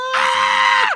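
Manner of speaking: high shrieked Ah; excited squeal. Retold or imitated. Strangers in the bathroom squealing with excitement. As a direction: squeal with delight. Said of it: "they started screaming".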